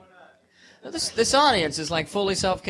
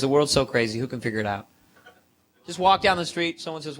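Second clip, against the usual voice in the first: normal talking voice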